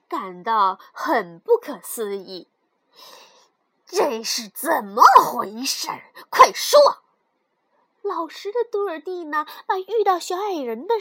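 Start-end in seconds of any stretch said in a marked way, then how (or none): 3.8-6.8 demanding stepmother. Acted. Stepmother demands the girl explain the gold, stern and loud. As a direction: demand it, then burst out loudly.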